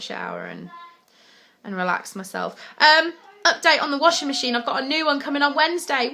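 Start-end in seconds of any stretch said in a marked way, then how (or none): none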